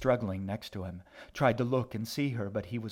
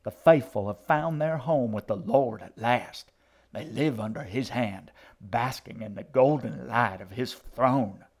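loud, exalted preaching; fervent old preacher's sermon; shrieking zealot; 2 semitones higher, 6 dB louder than usual. A narrator's reading. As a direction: extol paradise to the faithful. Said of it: "the old woman shrieked".

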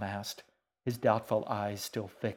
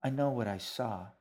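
ordinary delivery, nothing marked

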